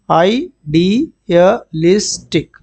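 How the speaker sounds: Idealistic stretched syllable by syllable; teacher slowly modelling idealistic; slow pronunciation demo. Shown as a demonstration. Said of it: "इसका pronunciation देख लो".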